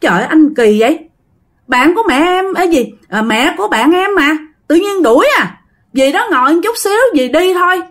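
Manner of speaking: high, loud, hurried pleading; wife begging her husband, high and loud; flustered protest. Retold or imitated. Wife protests husband chasing guest out.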